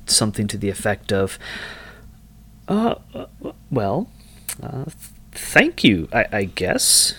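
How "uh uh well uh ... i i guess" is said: stammering, higher-pitched, thrown off guard; hidden double take. Acted. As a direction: hesitate and try to cover your surprise. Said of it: "there's an element of a double take".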